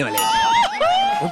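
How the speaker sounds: high, slow falsetto imitating the wife; mock wife voice. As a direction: squeak it out like his wife. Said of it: "हमारा बीवी हमको बोला". (पत्नी की नकल, ऊँची पतली आवाज़ में)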